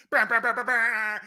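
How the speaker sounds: loud, high trilled brr like a ringing phone; phone ring imitation.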